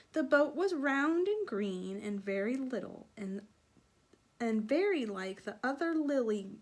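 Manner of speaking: put-on lively read-aloud voice for children; storybook narration. A narrator's reading. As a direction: tell it like a bedtime story. Reads story, voices frog Jeremy.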